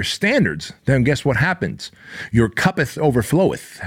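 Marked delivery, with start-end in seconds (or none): 2.3-3.8 higher, lightly hammed-up scripture tone; a hint of a preacher quoting the Bible; faux-holy proclamation; mock biblical. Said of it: "it sounds very religious and holy".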